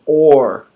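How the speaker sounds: or said alone, clear. Shown as a demonstration. Teacher showing a familiar word, clear. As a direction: say or with a curled R. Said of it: "you all know this word".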